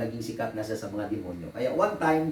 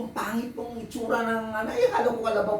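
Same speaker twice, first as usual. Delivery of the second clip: laughing quote; 10 semitones higher than usual. Retold or imitated. Woman's words retold with laughter.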